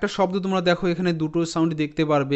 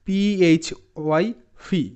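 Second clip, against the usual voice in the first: normal talking voice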